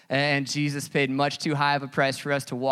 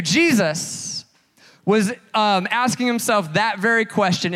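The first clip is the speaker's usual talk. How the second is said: louder and higher, playful stage energy; preaching at full force; 6 semitones higher, 6 dB louder than usual. Acted. Pastor teasing the congregation about country songs, loud and bright. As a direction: joke with the crowd, then punch the question.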